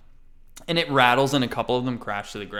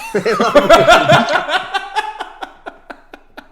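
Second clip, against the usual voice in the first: loud high burst of laughter; big laugh; 15 dB louder than usual. Real, not acted. Game master cracking up at his own joke. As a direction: crack up helplessly.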